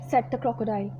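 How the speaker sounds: plain reading voice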